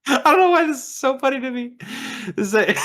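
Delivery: high giggling voice through laughter; can barely talk for laughing; squeaky helpless laughter; laughing hard. Real, not acted. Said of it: "And I laugh every time".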